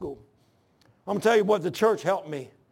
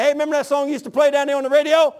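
lightly voiced Lord, higher, louder and quick; the Lord speaking; 5 semitones higher, 5 dB louder, faster than usual. The Lord asks about a song from the radio, lightly acted. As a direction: toss out a hearty, rapid question. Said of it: "And the Lord's gonna say".

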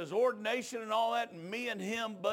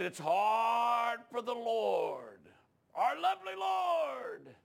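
slow preaching swell; 4 semitones higher, much slower than usual. Retold or imitated. Preacher voicing Brother Earl.

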